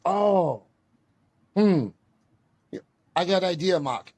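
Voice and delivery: a teacher hatching a plan; Sensei impression. Sensei thinks aloud to the class, lightly imitated in broken English. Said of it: "if I impersonate Sensei".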